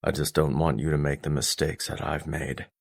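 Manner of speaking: low father's voice, earnest; low, protective fatherly tone; stern father, concerned. Acted. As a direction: caution your son in a low, restrained voice.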